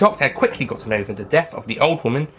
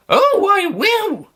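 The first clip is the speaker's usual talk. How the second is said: put-on high, slow, haughty old woman; a proud old lady, drawn out and indignant; 6 dB louder, much slower than usual.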